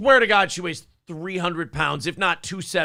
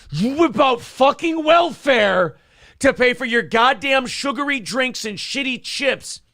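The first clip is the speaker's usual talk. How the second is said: retold angry rant; 5 semitones higher than usual. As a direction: shout it out, letting the rage boil over. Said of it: "I'm getting fucking mad".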